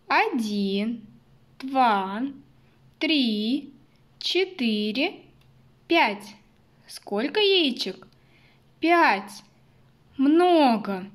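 put-on slow counting for little kids; counting with toddlers. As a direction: count aloud, building to a bright "Много". Counting eggs with children. (счёт вслух для малышей)

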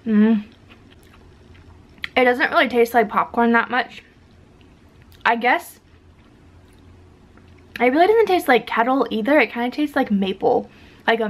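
chewing between words; talking while chewing. Real, not acted. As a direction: mouth partly full. Seen 1: bites cookie at -1.0, lips closed chewing while talking.

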